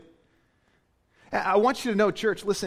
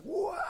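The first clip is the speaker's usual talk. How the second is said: mock joyful squeal; 14 semitones higher, 8 dB quieter than usual. Imitating mother's ecstatic cry.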